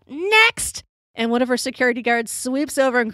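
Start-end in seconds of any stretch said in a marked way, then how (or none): 0.1-0.8 high-pitched imitated yell; mock yell. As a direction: call it out high, as an annoyed celebrity dismissing a fan. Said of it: "she just yells out".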